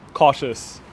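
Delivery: ordinary talk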